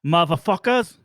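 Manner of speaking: mock British accent. Mocking DJ voice.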